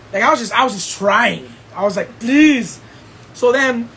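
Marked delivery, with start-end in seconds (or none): 2.2-2.8 begging. Retold or imitated. Acting out begging the sisters.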